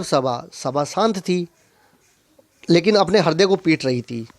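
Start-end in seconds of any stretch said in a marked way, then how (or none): none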